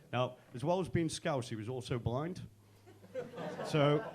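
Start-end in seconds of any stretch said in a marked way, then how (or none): none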